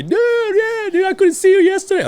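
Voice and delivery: very high, gushing excitement; laughing dad. Retold or imitated. Dad hugging the son he missed yesterday, gleeful. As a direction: imitate a dad whooping through a hug. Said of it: "he's all laughing and shit".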